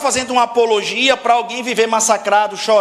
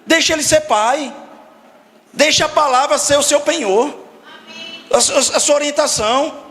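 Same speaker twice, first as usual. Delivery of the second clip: preaching; 5 semitones higher than usual. Acted. Preaching at rising force.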